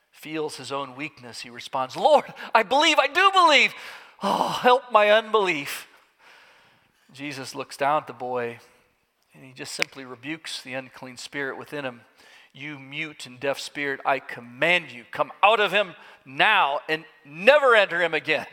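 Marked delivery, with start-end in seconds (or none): 2.0-5.9 high, loud cry of belief; desperate cry. Acted. Father cries out in conviction. 12.5-18.5 commanding rebuke, building. Acted. Jesus commands the spirit.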